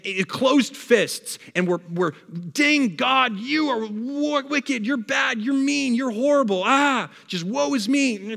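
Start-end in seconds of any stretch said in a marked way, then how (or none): 2.5-8.4 high, whiny shaking-fist complaint at God; mock grumbling rant. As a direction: act out an angry grumbler. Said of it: "Grumbling is when we raise our hands up in defiance".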